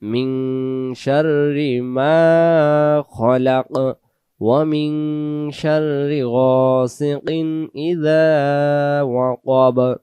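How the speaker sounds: drawn-out chanted tajweed recitation; Quran recitation. Acted. Teacher to students, reciting the sura aloud, chanted. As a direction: chant each verse slowly and melodically.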